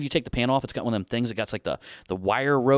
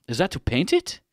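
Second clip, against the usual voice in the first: imitated Indian accent; 6 semitones higher than usual. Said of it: "just based on the accent there".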